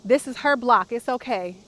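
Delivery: high sing-song pet voice, loud and gentle; coaxing the dog. Real, not acted. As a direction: coo reassurance to a nervous little dog. Still talking to the dog.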